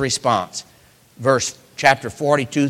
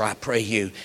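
normal talking voice

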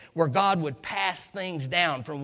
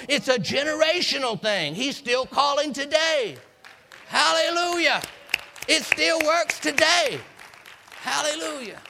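much higher and louder, crying out; ecstatic praise; 10 semitones higher, 3 dB louder than usual. Real, not acted. Preacher exulting to the congregation about God calling today. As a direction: soar up into hallelujah.

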